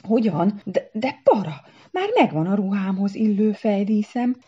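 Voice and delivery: loud, high, stammering objection; flustered protest. A narrator's reading. Szara protesting to Para about the headdress, flustered.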